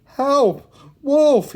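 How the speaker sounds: high, loud cry for help; desperate high shout. A narrator's reading. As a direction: cry out in alarm. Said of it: "he cried".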